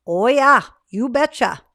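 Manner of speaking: put-on a playful Upper Midwest Wisconsin accent; mock Wisconsin accent, bright and loud. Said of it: "practiced my Wisconsin accent".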